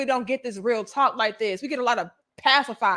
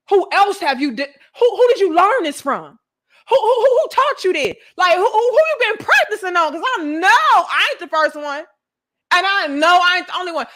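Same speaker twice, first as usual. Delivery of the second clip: louder and higher, escalating into a near yell on who who who; mock interrogating man; 5 semitones higher, 4 dB louder than usual. The hookup voiced as a suspicious man, rising to a mock yell. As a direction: grill her like a jealous man catching on. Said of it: "No, they're like".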